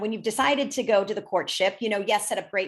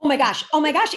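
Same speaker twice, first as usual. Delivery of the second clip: rising, louder oh my gosh; excited gush; 5 semitones higher, 5 dB louder, faster than usual. Real, not acted. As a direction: gush with delight. Gushing over love in seventies.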